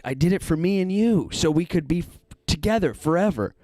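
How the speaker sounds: high, eager psycho fan; creepy devoted killer. Retold or imitated. Rob tells Billy why he killed everyone, high and eager. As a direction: lift it up like a smitten killer.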